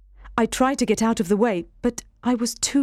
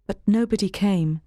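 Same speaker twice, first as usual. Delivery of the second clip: plain reading voice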